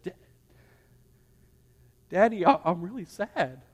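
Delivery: put-on soft, hesitant little-girl sadness; imitating crying child. Upset daughter confiding in Daddy, imitated soft sad voice. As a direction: quietly mimic a tearful daughter, halting. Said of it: "she's crying".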